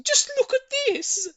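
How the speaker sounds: shrill with excitement, calling up from below; a lady yelling upstairs to her neighbour; excited shout. A narrator's reading. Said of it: "she was shouting".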